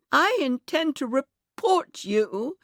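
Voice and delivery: high, slow, wounded indignation; almost crying. A narrator's reading. Miss Harper threatens to report the bus driver, almost crying. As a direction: draw it out high, close to tears. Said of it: "almost crying".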